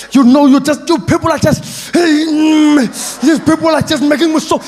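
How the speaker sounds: high, loud, building mock rage; angry protester. First toy-toyi protester demanding rights, hammed-up anger. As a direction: ham up a protester working himself into fury. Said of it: "The first guy standing there going".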